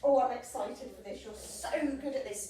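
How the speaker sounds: ordinary delivery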